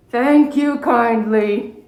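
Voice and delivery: put-on slow, sleepy old bear voice; drowsy thanks. Old bear thanking Mona while eyelids droop, slow and drowsy. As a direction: let it drift off, nearly asleep.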